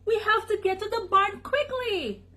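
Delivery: high, urgent dog's alarm; high-pitched urgent call; barked warning. A narrator's reading. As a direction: urge everyone along in a high voice. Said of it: "barked Doug".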